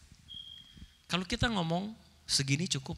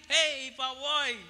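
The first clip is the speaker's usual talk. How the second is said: happy drawn-out greeting, higher pitch; happy reply; 6 semitones higher, slower than usual. Acted. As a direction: answer cheerfully, stretching it out. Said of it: "Pabil also feel happy". (balasan sapaan yang gembira)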